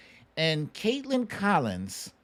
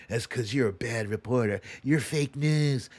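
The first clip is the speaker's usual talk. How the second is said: mock Trump insult; 4 semitones lower than usual. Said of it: "He made it personal".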